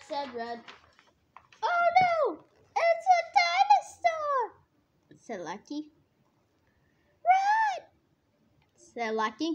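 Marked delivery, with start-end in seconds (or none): 1.6-4.5 very high, loud panicked squeal; alarmed scream. A narrator's reading. Lackey spots a dinosaur in the woods, shrieking. 7.2-7.9 very high, loud alarmed cry; worried shout. A narrator's reading. Lucky calls to Red about the dinosaur, worried shriek. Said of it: "getting worried".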